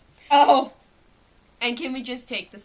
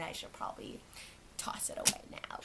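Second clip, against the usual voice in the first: whispered aside; 14 dB quieter than usual. Real, not acted. Aside while sorting wallet.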